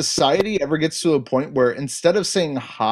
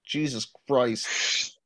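exasperated; 3 semitones lower, 7 dB quieter, much slower than usual. Retold or imitated. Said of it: "I'm like".

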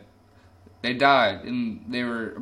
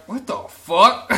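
higher, louder, drawn-out disbelief, slow; incredulous; 8 semitones higher, 8 dB louder, slower than usual. Real, not acted. Reacting to the army recruiter story, incredulous and slow. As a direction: stretch out the shock.

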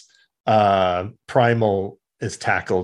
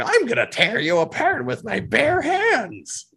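much higher put-on brute voice; a cartoon bully's threat; shrill villain; 13 semitones higher than usual. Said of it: "'cause he's like".

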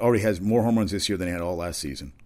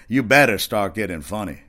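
louder retold heckle, leaning on "better"; mock crowd heckle, louder on "You better"; imitated heckler; 2 semitones higher, 8 dB louder than usual. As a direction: call it out like a heckler, hitting "better". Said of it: "she probably said it".

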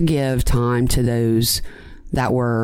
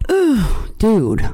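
disgusted groan; 7 semitones higher than usual. Real, not acted. Reacting to the killer's snap.

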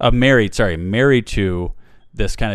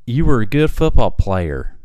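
raised, loud lisping Southern voice; 4 dB louder than usual. Retold or imitated. Host imitating the doctor recalling football days, Southern lisp. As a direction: mimic a feminine Southern man with a lisp. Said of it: "very feminine voice, uh, a lisp, and southern".

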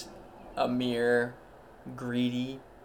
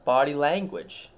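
ordinary talk

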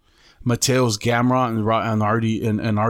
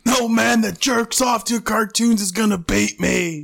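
quoting Strickland; 10 semitones higher than usual. Retold or imitated. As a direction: mimic the fighter's trash talk line. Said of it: "that shit's so funny".

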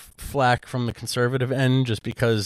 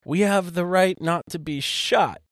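imitated shout; 7 semitones higher, slower than usual. Elderly woman's drive-by insult, as a mild imitated shout. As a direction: call it out slowly, pitched up, without real volume. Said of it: "she just shouted out".

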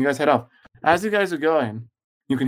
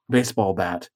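ordinary talk, nothing marked

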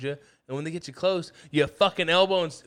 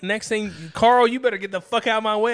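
louder and higher, threatening the next guy; mock angry threat; 5 semitones higher, 4 dB louder than usual. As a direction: warn the next one off like a brawler. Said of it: "I'm waking up angry".

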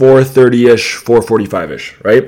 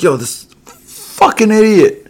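exasperated inner voice; 11 semitones higher, slower than usual. Retold or imitated. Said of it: "I'm just thinking".

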